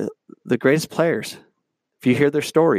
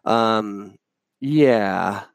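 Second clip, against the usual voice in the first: normal talking voice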